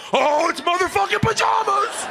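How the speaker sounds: high mock voice. Comedian voicing a guy's excited outburst, high mock voice. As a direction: squeal it out in a silly high voice.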